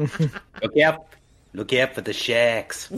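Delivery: attempted New Zealand accent; Kiwi accent. Film commentator imitating a Kiwi shark warning, Kiwi accent. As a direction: try a joking Kiwi accent. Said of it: "wanted to do a Kiwi accent".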